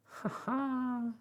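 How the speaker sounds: high, mocking bird cackle; jeering "ha ha" shrieked high; starling's shrill scornful laugh; rude shrieked laugh. A narrator's reading. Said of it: "shrieked the starling rudely from the window".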